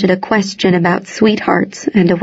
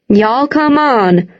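calling out; 3 semitones higher, slower than usual. A narrator's reading. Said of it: "Wash called".

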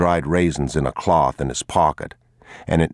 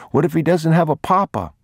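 put-on lighter, higher voice of a worried young boy; a small boy fretting aloud; boy's voice; 12 semitones higher, 3 dB louder, faster than usual. A narrator's reading. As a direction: ask it as an anxious child.

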